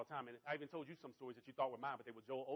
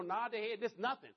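usual speaking voice

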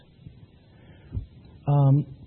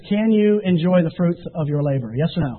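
ordinary talk

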